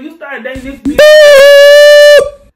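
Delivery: put-on drawn-out yell at the boy who lied; loud slow accusation. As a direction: yell the last words at him slowly. Confronting him in retelling.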